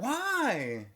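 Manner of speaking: high-pitched wail of disbelief; mock dismay. Host lamenting the killed dog, high mock dismay. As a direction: cry it out high, in pretend anguish. Feigns dismay at dog's death.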